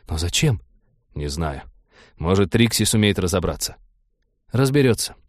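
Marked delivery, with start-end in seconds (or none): 4.5-5.1 confident. A narrator's reading. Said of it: "уверенно сказал Тед".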